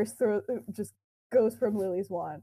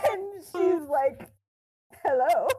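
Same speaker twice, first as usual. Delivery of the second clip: laughing; 7 semitones higher, slower than usual. Real, not acted. Laughing through the retelling.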